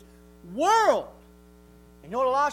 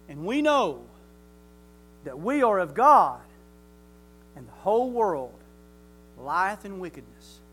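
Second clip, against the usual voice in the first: scripture reading; 5 semitones lower, 6 dB louder than usual. Acted. Reads scripture aloud.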